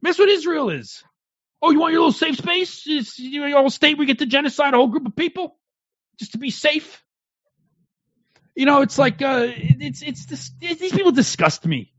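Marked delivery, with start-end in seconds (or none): 1.6-7.0 high-pitched mock incredulity; mocking taunt. Turning the taunt on opponents.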